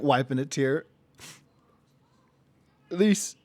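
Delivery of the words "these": higher slipped character voice; wrong voice. Acted. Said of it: "uh, wrong voice".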